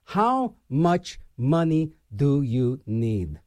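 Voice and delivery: slow low rhetorical question, drawn out; slow, pointed rhetorical jab; slow, heavy question. Real, not acted. Ranting question about greedy CEOs.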